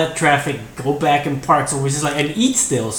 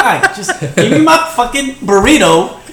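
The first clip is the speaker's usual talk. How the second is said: mock exasperation; 7 semitones higher, 5 dB louder than usual.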